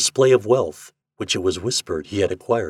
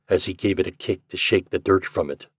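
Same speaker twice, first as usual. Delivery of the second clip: usual speaking voice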